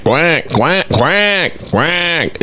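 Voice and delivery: high mock quacking like a duck; mock duck quacks for a lame throw. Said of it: "It looks like a duck".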